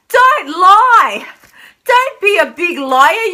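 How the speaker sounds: firm loud warning; emphatic plea. Real, not acted. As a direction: press the point home to the viewers. Urging viewers not to lie.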